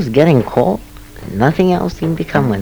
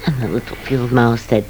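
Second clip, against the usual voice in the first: ordinary delivery, nothing marked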